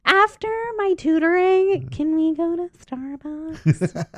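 put-on high, slow pleading kid voice. A girl asking her babysitter for Starbucks, high and slow. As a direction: drag it out like a hopeful little girl. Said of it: "She goes".

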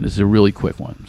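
ordinary talk, nothing marked

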